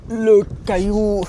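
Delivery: slow, loud, high goofy belch voice; silly burp voice. Acted. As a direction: drag it out in a silly burped voice. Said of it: "extravagant sur les bruits que je fais".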